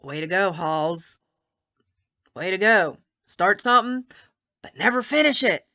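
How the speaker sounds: a bitter pat on her own back; sarcastic self-scolding. Real, not acted.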